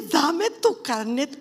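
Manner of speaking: loud, high, slowly stretched command; exaggerated retold demand. Preacher re-enacting the Lord's demand, loud theatrical drawl.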